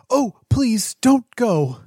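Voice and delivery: high, pleading cry; sudden calling out. Acted. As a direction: call after someone about to leave. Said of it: "she calls out suddenly".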